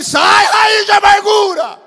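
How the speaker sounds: high, drawn-out rebuking cry; loud deliverance command; commanding preacher shout. Shown as a demonstration. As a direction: order it out at full voice. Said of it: "Fala assim".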